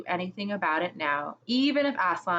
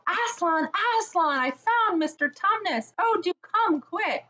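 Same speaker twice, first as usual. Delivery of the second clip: much higher excited call; shouting out; 11 semitones higher than usual. Acted. Lucy calling Aslan after finding Tumnus, high excited shout. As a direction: call joyfully up the stairs. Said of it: "shouting out".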